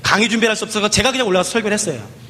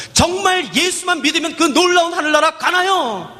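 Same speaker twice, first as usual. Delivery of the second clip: put-on higher, slow, a child's wondering voice; child's hopeful question; 7 semitones higher, slower than usual. Little girl asks the preacher again, slow and hopeful.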